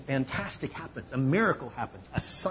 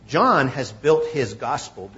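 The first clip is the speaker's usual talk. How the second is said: ordinary talk, nothing marked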